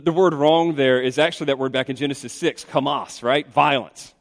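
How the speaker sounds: ordinary talk, nothing marked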